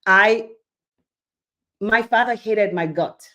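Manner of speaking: ordinary delivery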